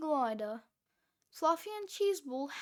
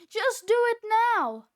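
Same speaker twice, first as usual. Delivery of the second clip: high and very loud, desperate; screaming; 7 semitones higher, 9 dB louder than usual. A narrator's reading. Fluffy ordering Cheeseball to hurry, screaming. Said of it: "screamed Fluffy".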